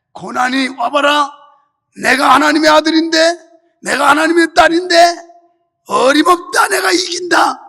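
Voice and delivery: loud, rising battle cry; bold defiance. Acted. Believer challenging hardship as God's child, bold defiant shout. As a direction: face hardship down with a confident shout. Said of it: "이렇게 당당히 맞서 싸워야지".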